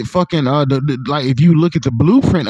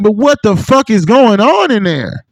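higher, louder, slow disbelieving question; mock bewilderment at the huge building; baffled outburst; 7 semitones higher, 4 dB louder than usual. Recalling his reaction to the Denver airport.

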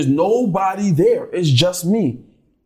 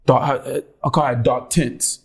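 ordinary talk, nothing marked

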